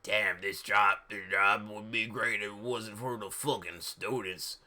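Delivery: low put-on character voice; Mister Norris's voice. A narrator's reading. Grumbling about the students to Lupin, low put-on voice. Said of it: "Mister Norris agreed".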